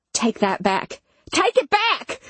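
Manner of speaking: building to a high, strained yell; screamed demand. A narrator's reading. Imogen denying narrator's accusation, rising into a scream. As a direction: start firm, leap up into a scream. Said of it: "she screamed in my ear".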